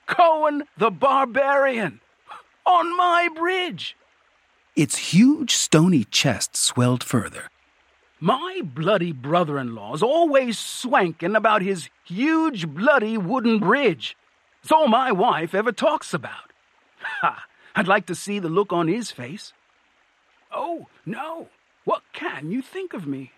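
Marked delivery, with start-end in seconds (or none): 0.1-4.0 high, starstruck exclaiming; thrilled troll. A narrator's reading. Troll marvelling at its famous visitor, thrilled. 8.2-23.3 high-pitched excited troll chatter; chatty, starstruck troll. A narrator's reading. Troll bragging about Cohen's visit, high and excited. Troll figure speaks in story.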